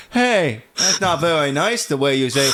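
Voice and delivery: playful mock-serious kid voice; little boy voice. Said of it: "completely straight face".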